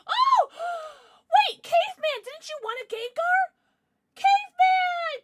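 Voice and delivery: high, loud squealing excitement; excited shouting. Real, not acted. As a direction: shriek with real joy. Pulls a wanted card.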